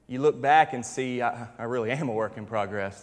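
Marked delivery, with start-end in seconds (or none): 1.2-3.0 quiet realization. Retold or imitated. Same thought repeated, softer.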